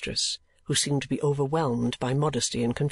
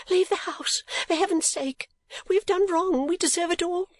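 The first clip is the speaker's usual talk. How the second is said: put-on much higher pleading girl's voice; distraught young lady. A narrator's reading. Young lady begging Nicholas to leave, distraught. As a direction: beg him to flee, in distress. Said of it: "cried the young lady".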